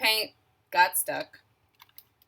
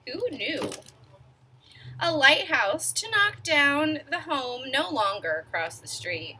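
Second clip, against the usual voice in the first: ordinary delivery, nothing marked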